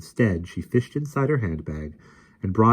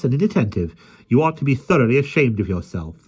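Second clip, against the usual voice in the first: scolding; 5 semitones higher, 5 dB louder than usual. A narrator's reading. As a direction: tell the dog off properly. Mary scolding the lion.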